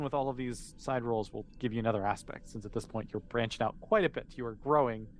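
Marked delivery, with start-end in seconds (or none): none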